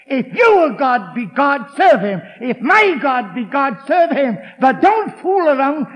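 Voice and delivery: loud, ringing rebuke to the crowd; booming challenge. Retold or imitated. Preacher thundering Elijah's challenge at the people. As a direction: thunder it like a prophet.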